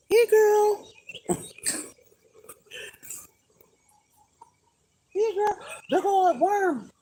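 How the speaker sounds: high, sing-song coaxing voice for a chicken; pet voice. Acted. Owner coaxing her chicken to eat the worm, high cooing voice. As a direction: coo at the hen like a baby.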